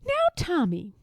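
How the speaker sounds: high, sing-song well, well; a kindly teacher musing; art teacher voice. A narrator's reading. Art teacher addressing Tommy.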